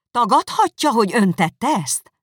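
high, loud challenging question; indignant demand. Acted. Elizabeth repeating her question to Darcy, high and indignant.

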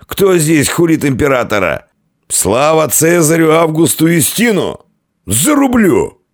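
high, drawn-out warrior's roar; drunken bellowing. A narrator's reading. Drunk barbarian bellowing slogans.

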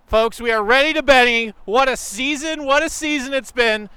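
loud, high, fast sports reporter; breathless sideline reporter. Pretend.

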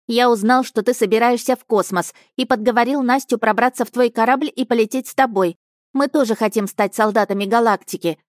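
put-on a small boy reporting eagerly to his captain; boy's voice. Child Alyosha voiced by narrator.

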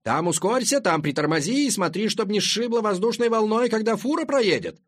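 loud, high-pitched excited yell; shouting. A narrator's reading. One Italian goofball calling to the other across the ring road, shouting. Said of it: "кричит второму".

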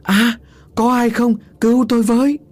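shrieked plea; panicked scream. A narrator's reading. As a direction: scream for someone to save you. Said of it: "một tiếng thét thất thanh".

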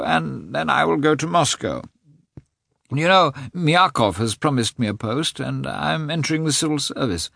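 a frail man forcing bright plans; sick man putting on cheer. A narrator's reading. Nikolai voiced in narration.

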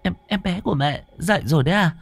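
put-on very high, stammering baby-talk; forced cheer through fear. Scared mother pretending her baby is awake, forced cheerful cooing. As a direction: coo brightly while holding back tears. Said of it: "giả vờ cười cười".

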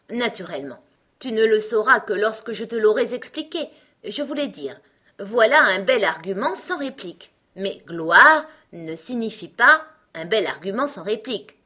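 loud, condescending explanation; contemptuous. A narrator's reading. Gros Coco defines glory for Alice, scornful. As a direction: explain as if it were obvious. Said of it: "sourit d'un air méprisant".

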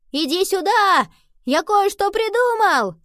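loud, high-pitched call, drawn out; shouting over wind. A narrator's reading. Henry calls Nova through the storm with an idea, shouting. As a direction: yell it across the noise of the wind. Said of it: "Сквозь шум ветра Генри крикнул".

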